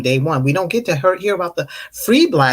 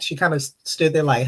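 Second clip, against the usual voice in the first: ordinary talk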